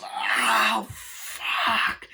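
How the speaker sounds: rasping, drawn-out acted scream; hammed-up howl of pain. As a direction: scream the curse as if just shot. Acts out scream after shot.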